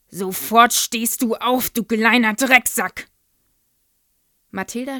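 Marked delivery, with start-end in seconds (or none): 0.1-3.1 loud, slow raging order; furious shout. A narrator's reading. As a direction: bellow the insult slowly. Headmistress orders pupil.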